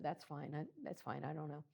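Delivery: soft, offhand giving-in; quiet acquiescence. Retold or imitated. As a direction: let it go under your breath. Retold indifferent reply to husband.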